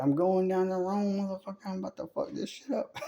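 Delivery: hammed-up street bravado; mock gangster Jesus. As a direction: brag like a gangster heading out.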